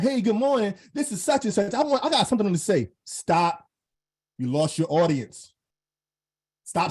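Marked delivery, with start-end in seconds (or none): 0.0-2.9 mock video intro. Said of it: "never start your video off with".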